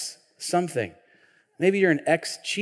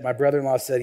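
ordinary talk, nothing marked

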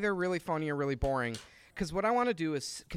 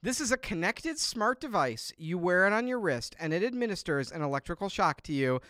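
announcer voice; 5 semitones higher, 7 dB louder than usual. Pretend. Hosts a mock product launch.

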